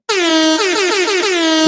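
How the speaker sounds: long high bleating goat call. Pretend. [name] answers the goat-sound challenge with a bleat. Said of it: "I'll do a goat sound".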